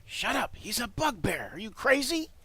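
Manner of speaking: high, loud frantic hiss at a fellow goblin; panicked goblin. Acted. Goblin scolds his fellow guard.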